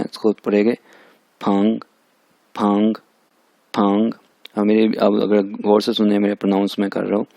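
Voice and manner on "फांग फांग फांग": फांग with a rising second tone. Shown as a demonstration. Teacher demonstrating second tone on फांग, rising tone. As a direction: lift the pitch through each फांग. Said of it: "यहाँ पे second tone है".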